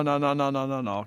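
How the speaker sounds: ordinary talk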